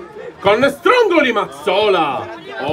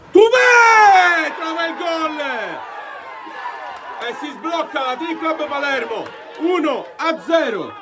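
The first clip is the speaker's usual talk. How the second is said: breathless italian goal commentary; 8 semitones higher, slower than usual. Real, not acted. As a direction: explode with joy on the goal. Goal scored, commentator screams.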